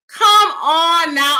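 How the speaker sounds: Come, on, now, drawn out and loud. Real, not acted. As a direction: Come on now stretched slow, high and loud. Reacting to a comment.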